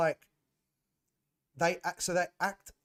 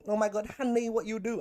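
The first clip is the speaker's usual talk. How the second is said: put-on a light camp Kinezo accent, pitched higher; playful higher Kinezo accent; 8 semitones higher, 4 dB louder than usual. Mocking app users in a light Kinezo accent. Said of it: "I used to do that whole accent".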